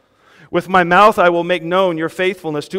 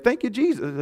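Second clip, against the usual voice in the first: mock excitement; 6 semitones higher, 5 dB quieter than usual. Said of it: "He's all excited".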